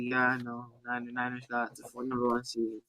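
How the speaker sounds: low, very soft, barely-bothered monotone; chill Nick Bosa. Retold or imitated. Podcast host mimics player answering top-seed news, chill and very soft. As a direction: imitate an athlete shrugging off big news, quietly. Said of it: "always that chill demeanor".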